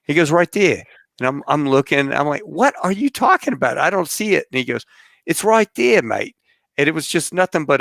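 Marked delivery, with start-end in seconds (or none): 0.3-0.8 imitated Kiwi accent, higher; mock New Zealand insistence; New Zealand pilot. Pilot friend answers the puzzled passenger, imitated New Zealand accent. 2.4-4.5 mock-incredulous protest. Passenger can't spot the airstrip, acted incredulous. Baffled passenger retelling his reply. 5.3-6.4 imitated Kiwi accent, high swoop on THERE. Pilot insists to doubting passenger, imitated New Zealand accent.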